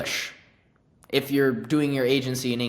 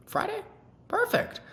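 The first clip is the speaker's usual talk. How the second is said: mock high squeak. Prospect answers, seller replies.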